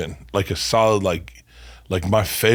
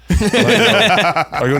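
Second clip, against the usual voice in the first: laughing; 10 semitones higher, 5 dB louder, slower than usual. Real, not acted.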